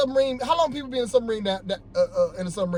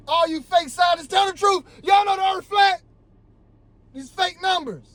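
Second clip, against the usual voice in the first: high, raised furious demand; angry shout; 8 semitones higher than usual. Real, not acted. Vlogger yelling at fake scientists, angry and high. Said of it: "Sorry for getting mad".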